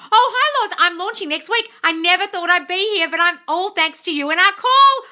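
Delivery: high, loud gushing fan reading her own comment. Pretend. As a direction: squeal it out like an excited follower.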